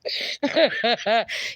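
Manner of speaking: helpless chuckling fit; real laughter. Real, not acted. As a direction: laugh it out freely. Laughing at Norma's story.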